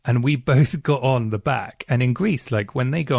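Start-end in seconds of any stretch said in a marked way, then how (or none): none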